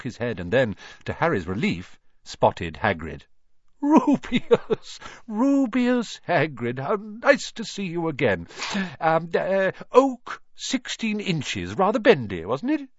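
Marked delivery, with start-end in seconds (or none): 3.8-8.5 high, loud cry of recognition; old wandmaker's excited, drawn-out hello; delighted greeting. A narrator's reading. Old wandmaker spots an acquaintance. 9.0-12.9 eager, high-pitched recollection; excitable old shopkeeper; old wandmaker. A narrator's reading.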